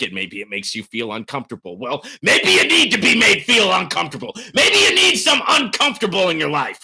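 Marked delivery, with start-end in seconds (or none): 2.2-6.8 high, loud preachy shout; hollering. Real, not acted. Streamer making a viewer uncomfortable on purpose, hollering. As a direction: holler it to jolt the listener. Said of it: "You don't like the hollering".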